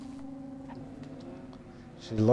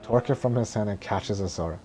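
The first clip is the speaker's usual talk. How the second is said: ordinary talk, nothing marked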